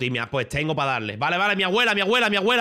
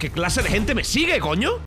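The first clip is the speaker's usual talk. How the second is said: exasperated; 6 semitones higher, slower than usual. Real, not acted. Exasperated rant at his chat.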